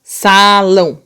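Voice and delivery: SALÃO stretched on both syllables; slow demonstrative reading. Shown as a demonstration. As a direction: say SALÃO slowly, long first A. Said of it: "Agora aqui, ó, no salão".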